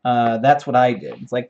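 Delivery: ordinary talk, nothing marked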